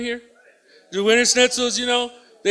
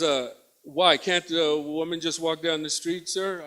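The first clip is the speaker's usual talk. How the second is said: lightly voiced woman, lower and softer; questioning woman; 5 semitones lower, 8 dB quieter, slower than usual. Stopped woman questioning the officer, quietly challenging.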